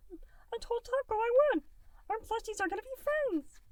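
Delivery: a tiny blob kid, sweet and distracted; little gelatinous Goo. A narrator's reading. Little Goo putting off dinner, high and bright. Goo's voice.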